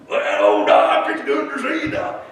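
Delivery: big-mouthed loud greeting, pitch jumping high; Larry. Retold or imitated. Patient with a big mouth greets his doctor, loud. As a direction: holler a hello at the doctor. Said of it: "Larry in his big mouth".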